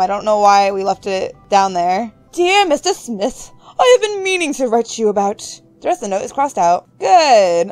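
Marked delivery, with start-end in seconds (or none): 2.3-5.4 high, affected reading voice; hammed-up high letter reading; put-on letter-writer voice. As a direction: read the letter aloud in a high, theatrical voice. Reads a letter aloud in character.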